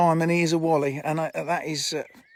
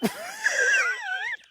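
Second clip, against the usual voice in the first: a shrill burst of real laughter; high shrieking laugh. Real, not acted. Friend cracks up at Bruce's antics, shrieking laugh.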